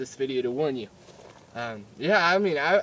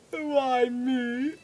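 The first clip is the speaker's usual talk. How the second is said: high whimpering cry; hammed-up tearful self-pity; mock despair; 9 semitones higher than usual. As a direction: wail it as a doomed patient. Patient despairs in the chair.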